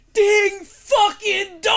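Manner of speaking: huge, high, stretched-out shout; top-of-lungs yell. Retold or imitated. Drunk friend announcing himself at the door, full-lung yell. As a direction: bellow it like a doorbell, every word dragged out. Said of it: "yells at the top of his lungs".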